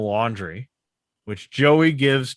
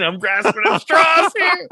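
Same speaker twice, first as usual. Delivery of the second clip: much higher, louder, flustered; exasperated; 8 dB louder than usual. Real, not acted. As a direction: throw it up high in mock desperation. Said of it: "I'm trying".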